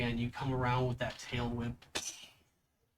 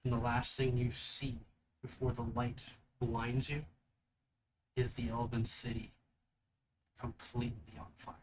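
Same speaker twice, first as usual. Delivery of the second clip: GM scene narration. Acted. GM describes the vision.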